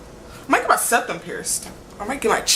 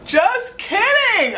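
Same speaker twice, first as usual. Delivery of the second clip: high, drawn-out giggly just kidding; sing-song it through a laugh; laughing tease; 12 semitones higher, 4 dB louder, much slower than usual. Real, not acted. After bringing up Alan, laughing drawn-out tease.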